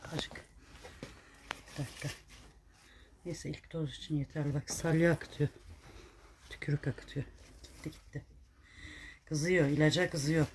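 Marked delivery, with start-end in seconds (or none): 0.1-8.3 hushed murmur. Real, not acted. Murmuring while giving medicine.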